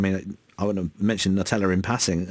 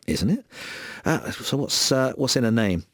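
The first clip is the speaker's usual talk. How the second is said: usual speaking voice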